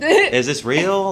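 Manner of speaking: high, drawn-out incredulous question; retold disbelief. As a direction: stretch it out high, as if astonished. Retold disbelief at chart success.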